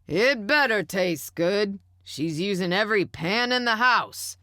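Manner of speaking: loud, high yell; yelling from another room. A narrator's reading. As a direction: call out from the next room. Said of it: "Stanley yelled from his recliner".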